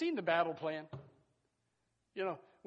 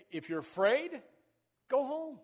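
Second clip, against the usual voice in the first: rising, louder preaching; preaching cry; 7 semitones higher, 6 dB louder than usual. Acted. Preacher sends the fearful home, loud and higher. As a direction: call it out higher.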